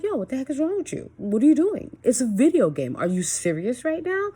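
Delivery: high, loud retold telling-off; exasperated scolding. Mom re-enacting telling off her gaming son, exasperated. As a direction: act out an incredulous parent's scolding.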